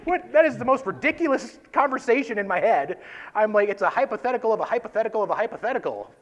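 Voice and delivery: exasperated rant at his own thinking; exasperated outburst. Real, not acted. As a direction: throw up your hands at the absurdity.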